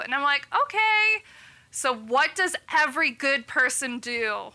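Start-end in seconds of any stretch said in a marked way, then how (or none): 0.5-1.2 a much higher, startled okay; high stunned okay. Retold or imitated. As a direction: squeak it out, caught off guard. Retold reply to doctor's news.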